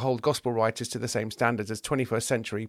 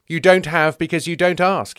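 raised, loud sermon delivery; preaching rebuke; 6 semitones higher, 7 dB louder than usual. Acted. James lectures the rich man, preaching. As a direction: admonish him like a preacher.

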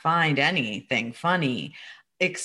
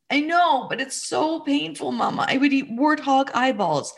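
little boy, much higher, half crying and half playing along; child's voice; 9 semitones higher than usual. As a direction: voice the small son as he starts to joke. Son's voice in retold dialogue.

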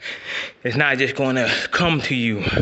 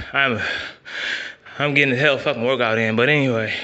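ordinary talk, nothing marked

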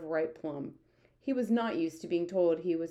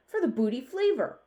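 higher, louder giant's interjection; excited cry; 7 semitones higher, 5 dB louder than usual. A narrator's reading. BFG interrupts the army head again, crying out. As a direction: call it out eagerly. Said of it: "cried the B F G".

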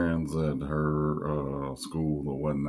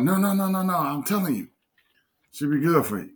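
much higher, louder insistent friend voice; mimicking Lisa insisting; 13 semitones higher, 6 dB louder than usual. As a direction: push back hard in her voice.

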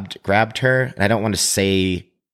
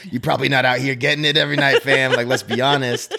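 mocking jab; 5 semitones higher, faster than usual. Mocking the churchgoer to his face.

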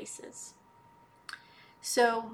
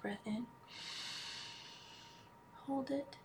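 guided meditation; 8 dB quieter than usual. Acted. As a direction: ease them into calm, almost whispering. Leads a healing meditation.

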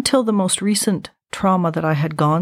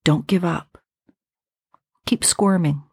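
hushed voice. Retold or imitated. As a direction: murmur the encouragement. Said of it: "all I heard was a voice say".

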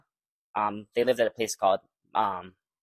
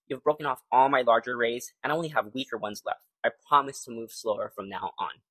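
ordinary delivery, nothing marked

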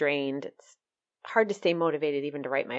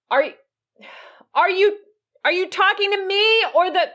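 higher, louder call downstairs; 13 semitones higher, 7 dB louder than usual. Real, not acted. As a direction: shout down to the dad. Shouting down to dad.

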